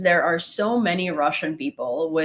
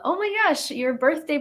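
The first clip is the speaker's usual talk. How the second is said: surprised squeal; 10 semitones higher than usual. Real, not acted. Reacting to a birthday gift.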